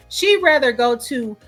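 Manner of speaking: usual speaking voice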